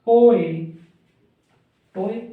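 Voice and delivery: loud teaching call of ओए; emphatic demo. Shown as a demonstration. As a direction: punch out ओए loudly for the class. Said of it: "T बना दिया".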